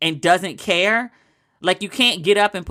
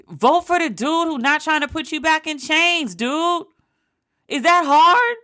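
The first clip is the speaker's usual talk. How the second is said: pitched-up exasperated outburst at the voter; exasperated high yell; 9 semitones higher than usual. Acted. Exasperated rant at undecided voter.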